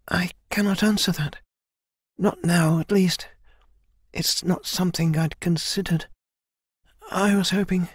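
high-pitched, hesitant reply; troubled young heroine. Acted. Kai answers elder about fighting own sister, troubled. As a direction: falter between phrases in a lifted voice.

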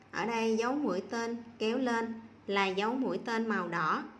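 ordinary talk, nothing marked

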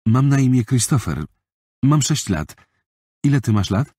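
put-on a six-year-old introducing himself; young boy's voice. Boy introduces himself to girl. (wyższy głos sześcioletniego chłopca)

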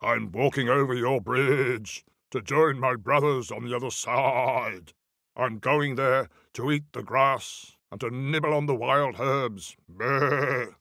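steady, unbothered goat voice; put-on goat voice ending in a bleat; biggest billy goat. A narrator's reading. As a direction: state your business as a big bleating goat. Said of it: "said the biggest billy goat gruff brother".

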